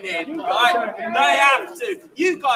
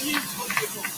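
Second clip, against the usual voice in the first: soft murmur; 11 dB quieter, much faster than usual. Real, not acted. Murmured praise to street cleaners.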